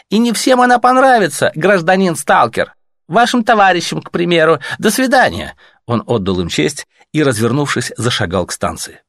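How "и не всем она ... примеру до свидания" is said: higher, louder, officious and polite; a bureaucrat brushing off visitors at night; cool Reich officer. Acted. Reich officer answering the messengers, officious.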